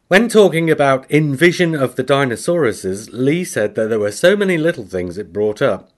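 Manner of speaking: ordinary talk, nothing marked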